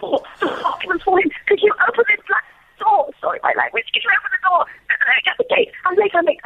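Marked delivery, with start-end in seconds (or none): 0.0-3.1 high, panicky public school English; frantic public school accent. Retold or imitated. Bluffing past security guards at the gate, frantic public school accent. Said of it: "frantic mode with my best public school accent". 3.9-6.4 high, panicky public school English; frantic public school accent. Retold or imitated. Bluffing past security guards at the gate, frantic public school accent. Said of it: "frantic mode with my best public school accent".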